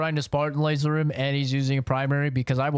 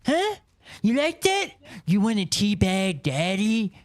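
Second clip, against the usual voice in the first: taunting shout; 11 semitones higher, 5 dB louder than usual. Acted. Taunting enemy as daddy.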